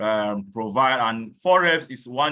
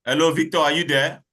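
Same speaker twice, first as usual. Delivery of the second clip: calling out; 6 semitones higher, 6 dB louder than usual. Real, not acted. Calling for an absent colleague online.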